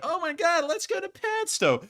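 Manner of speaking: imitating their excited past selves; retold excitement. Retelling seeing Padstow across the bay, high mock excitement.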